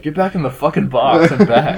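irritated umpire ordering him back into the box; umpire yelling. Retold or imitated. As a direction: snap at the batter like an annoyed ump.

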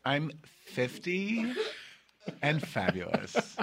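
lightly put-on comic character, drawn out slowly; hint of a bit, stretching each word out; Sally O'Malley. Co-host answers her age question, lightly hammed-up Sally O'Malley. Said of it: "Okay, Sally O'Malley".

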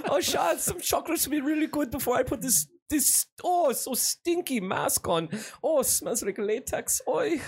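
high, whiny cartoon German complaint; German actor character. Actor dreading his stinky latex mask, hammed-up German fussing. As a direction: fuss in a put-on German voice.

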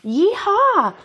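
high whooping cowboy yeehaw. Pretend. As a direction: holler it like a cowboy. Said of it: "That is quite an introduction".

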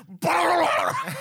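laughing mock demon growl. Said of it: "into the river going".

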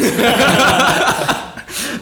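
high, loud laugh bursting out; loud burst of laughter. Real, not acted. Cracking up at his friend's joke, loud laugh.

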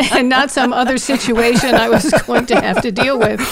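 talking through laughter; real laughter. Real, not acted. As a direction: struggle to finish while cracking up. Laughing at own relief in story.

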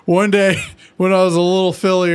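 lightly voiced pony recalling; playful character quote; quoting Quill. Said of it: "He's like".

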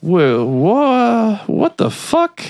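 very high, stammering disbelief; mock shock. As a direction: act dumbfounded, voice shooting way up. Retold Bill stunned by the sight.

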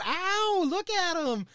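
mock crowd whoop. Crowd cheering them on.

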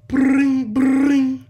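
high, loud trilled ringing noise; imitated phone ring. Storyteller mimics the long-awaited phone call, high loud ring.

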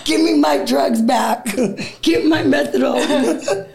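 loud, high protest broken by laughter; laughing mock rage. Detoxing woman refusing rehab, loud laughing mock shout. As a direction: rant with a laugh, half furious.

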